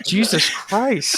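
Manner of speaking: drawn out, high, loud shock; slow appalled gasp; stunned exclamation. Real, not acted. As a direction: stretch it in appalled disbelief. Reacting to offensive remark.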